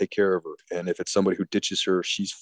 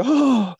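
high, loud falsetto "oh"; mock swooning gasp; 8 dB louder than usual. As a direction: swoon in an imitated woman's voice. Acts woman swooning at proposal.

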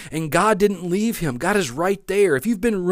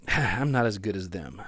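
discouraged self-talk; 7 semitones lower, 5 dB quieter than usual. Acted. Discouraged self-talk after a sigh.

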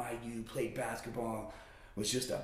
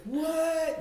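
high, loud startled squeal; mock startled reaction; 8 dB louder than usual. Boy reacting to his aunt's praise, startled. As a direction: play up the bewildered surprise.

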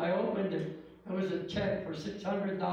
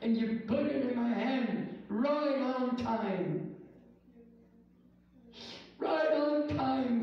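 fervent praise; 3 semitones higher, slower than usual. Acted. Climax of the prayer.

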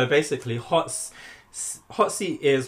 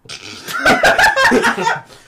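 loud laugh; 12 dB louder than usual. Real, not acted. Host cracks up at question.

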